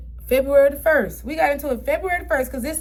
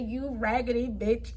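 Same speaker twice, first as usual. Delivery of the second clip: softer, lower, slow sneer; low insult; 4 semitones lower, 7 dB quieter, slower than usual. Retold or imitated. Narrator recalling the insult she hissed at the woman, slow and low. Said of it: "all I know is I said".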